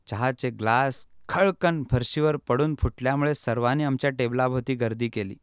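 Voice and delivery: ordinary delivery, nothing marked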